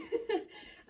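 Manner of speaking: breathy high giggle; embarrassed giggle. Real, not acted. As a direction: laugh it off, embarrassed. Laughs at own mistake.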